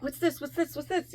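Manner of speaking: high, quick, small-voiced repeated questions; Energizer Bunny. Friend mimicking her nonstop questions, hyper and high. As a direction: imitate an overexcited customer, chirped on repeat. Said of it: "You sounded like Energizer Bunny".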